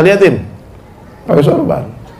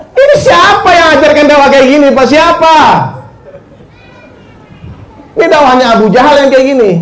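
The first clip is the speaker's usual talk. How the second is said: indignant rebuke; 8 semitones higher than usual. Real, not acted. Preacher scolding the congregation about harsh da'wah, indignant. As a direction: demand an answer, outraged.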